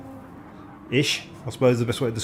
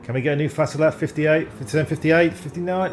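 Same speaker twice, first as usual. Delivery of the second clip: ordinary delivery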